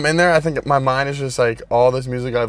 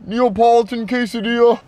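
put-on Canadian accent; 9 semitones higher, 4 dB louder than usual. Ordering a smoothie and quesadilla at the counter in a Canadian accent. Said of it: "my Canadian accent".

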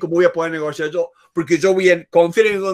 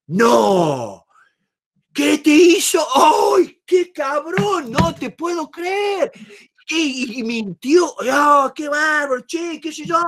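put-on match the kid's anger, much higher; indignant sympathy; 6 semitones higher, slower than usual. Shown as a demonstration. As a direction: play the outraged ally, slow, shouted exclamations. Parent siding with upset child.

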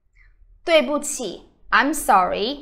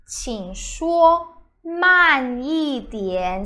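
slow; much slower than usual. Shown as a demonstration. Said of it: "please speak slowly".

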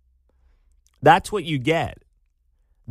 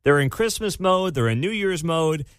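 usual speaking voice

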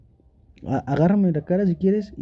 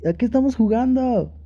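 ordinary talk

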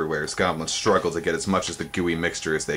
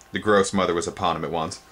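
plain reading voice

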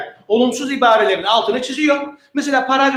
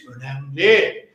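slow, low emphasis; 9 semitones lower, much slower than usual. Shown as a demonstration. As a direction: drop low and drag out Önemli. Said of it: "Altını çiz".

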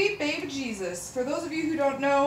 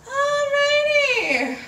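1 high stretched; 7 dB louder, much slower than usual. Real, not acted. Starting measurements, emotional.

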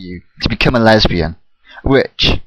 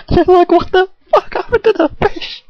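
crying with laughter, voice gone; sobbing with laughter on the quote; laughing. Real, not acted. Laughing through the punchline.

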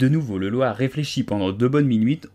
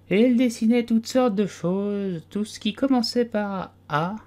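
a dozy little animal insisting it was awake; sleepy Dormouse; 11 semitones higher than usual. A narrator's reading. Dormouse defending himself to the tea party, drowsy and high.

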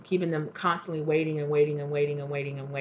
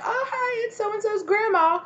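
put-on very high, chirpy old-lady greeting; the supposedly dead grandma calling, very high and sweet; 3 dB louder than usual. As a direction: play the cheery grandma on the phone. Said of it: "They're like".

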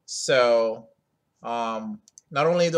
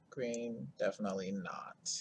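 whispered; 4 semitones lower, 14 dB quieter than usual. Real, not acted. Rejecting a colour.

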